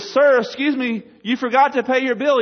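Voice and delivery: stretched-out, high acted call; waiter calling out. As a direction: holler after a customer walking out. Waiter calls after departing minister.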